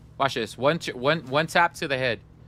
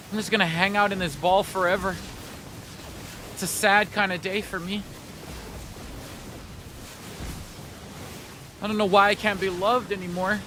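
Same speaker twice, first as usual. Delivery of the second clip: mock sad; 2 semitones higher than usual. Mock sad monologue inside ball.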